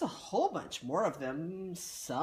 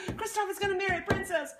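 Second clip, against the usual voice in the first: high, fast, loud proclamation of gossip; excited shout; 13 semitones higher, 4 dB louder, much faster than usual. Acted. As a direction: trumpet the news to everyone.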